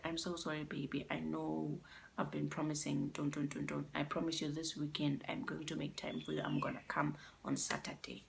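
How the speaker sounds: hushed and sorry, as she said it; softly pleading girlfriend; soft apology. Retold or imitated. Girlfriend's apology voiced by narrator.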